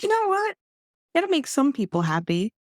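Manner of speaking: high, bright reassurance; upbeat self-talk. Retold or imitated. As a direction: cheer yourself on. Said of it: "I'm like".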